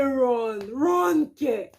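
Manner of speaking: high, loud retold cry of disbelief; exasperated retort. Retold panicked answer about running.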